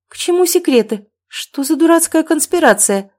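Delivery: high, loud exasperated questions; indignant challenge. A narrator's reading. Mother challenges son's secrecy, indignant challenge.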